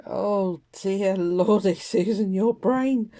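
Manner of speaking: loud, slow, self-mocking groan of dismay; exasperated exclamation. Real, not acted. Crafter scolding herself for redrawing a done card, loud and slow. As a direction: scold yourself loudly for a silly mistake.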